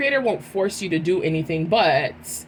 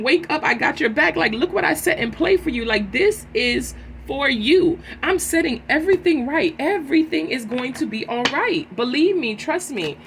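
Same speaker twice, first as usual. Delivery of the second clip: high, loud, excited reassurance from Spirit, higher and louder; Spirit encouraging; 6 semitones higher, 4 dB louder than usual. Pretend. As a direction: cheer them on as Spirit who has their back. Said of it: "Spirit is saying".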